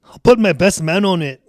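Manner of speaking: put-on Scottish brogue, a touch of anger; angry Scottish accent. [name] opening the table read, angry Scottish accent. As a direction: play an irritated Scotsman. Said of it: "gotta do a Scottish accent".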